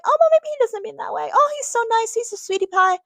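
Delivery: high, sing-song gushing voice; mock the naive fan who gushes over him; mock-sweet high fangirl gush. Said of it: "That's so silly and naive of us to be like".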